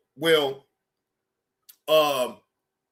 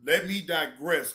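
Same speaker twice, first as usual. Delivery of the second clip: usual speaking voice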